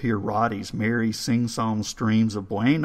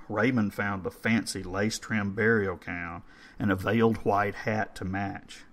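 plain reading voice

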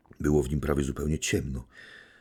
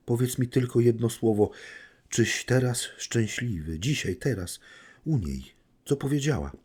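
a higher, urgent woman's voice begging for an answer; pleading woman; 7 semitones higher than usual. Acted. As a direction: press him for one word, anxious and imploring. (błagający kobiecy głos, niespokojny i natarczywy)

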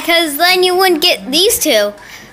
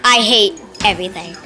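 annoyed dog; 5 semitones lower, 4 dB quieter than usual. Pretend. Sadie the dog begging rescue from puppies, mock annoyed. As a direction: voice a fed-up dog, mostly subdued. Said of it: "Sadie's just so annoyed".

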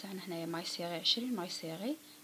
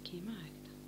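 whisper; 6 dB quieter than usual. Real, not acted. Whispered aside while stitching.

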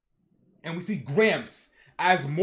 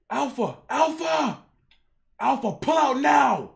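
building to a high, loud shout; urgent calling; 8 semitones higher, 6 dB louder than usual. Acted. Commander orders Alpha to retreat, urgent rising shout. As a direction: call out, more forceful each time.

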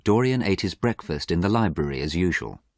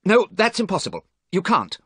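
high, loud protest; agitated, raised-voice refusal; shouting; 13 semitones higher, 4 dB louder than usual. A narrator's reading. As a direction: forbid it urgently. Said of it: "shouted Dorian".

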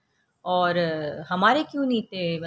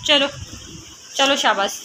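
high coaxing mom voice; 8 semitones higher, 3 dB louder than usual. Real, not acted. Mother coaxing her angry daughter to draw, high and sing-song.

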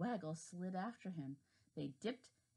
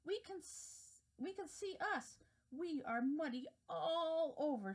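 high laughing dismay; 9 semitones higher than usual. Acted. Worm sees itself muddy.

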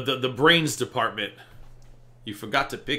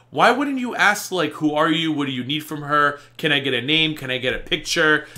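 exasperated scolding; 3 semitones higher, 5 dB louder than usual. Acted. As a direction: fire off questions in loud exasperation. Mock-scolding husband for not asking questions.

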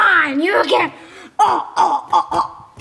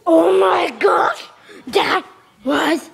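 usual speaking voice

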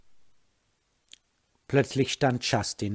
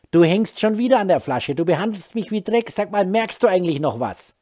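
furious reproach; 6 semitones higher, 8 dB louder than usual. Acted. Wife confronts drunk husband.

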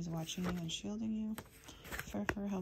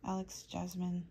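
ordinary talk, nothing marked

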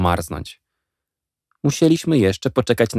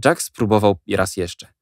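plain reading voice

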